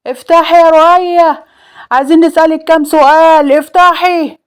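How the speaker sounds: high, loud demand through a door; urgent shouting. A narrator's reading. Salem yelling at Roaya from outside to open up, urgent shouting. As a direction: call out hard, pitched well up.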